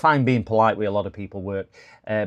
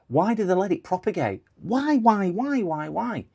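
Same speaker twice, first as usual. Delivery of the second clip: exasperated rant; 10 semitones higher than usual. Real, not acted. Ranting about fake printer ads.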